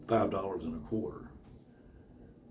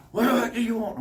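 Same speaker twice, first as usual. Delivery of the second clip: high, put-on snappy woman; hammed-up surly clerk; mock rude clerk; 13 semitones higher, 5 dB louder than usual. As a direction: bark at the customer.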